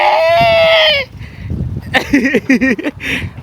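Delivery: high shrieking laugh; big laughing shout. Real, not acted. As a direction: burst into a loud shrieking laugh. Burst after cheering. (grand éclat de rire aigu)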